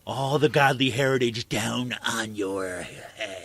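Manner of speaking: slow, drawn-out hint of a menacing politician; lightly voiced bully lawmaker, slowed down; mock Saccone threat. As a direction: savour the threat slowly.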